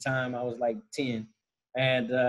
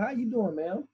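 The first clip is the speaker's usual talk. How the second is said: manager's greeting; 8 semitones higher than usual. Retold or imitated. Manager greeting grandmother at the table, high-pitched imitation. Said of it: "the manager comes over and says".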